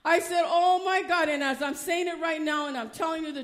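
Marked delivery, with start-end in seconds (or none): 0.5-1.3 loud, high gasp of alarm; shocked cry, raised in pitch and volume; retold shocked exclamation. Retold shock at seeing the shadow.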